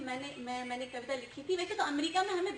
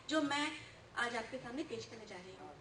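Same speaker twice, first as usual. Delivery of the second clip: ordinary talk, nothing marked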